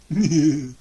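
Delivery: short witch chuckle; witch laugh. Acted. Baba Yaga laughing over the spoons. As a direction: cackle briefly.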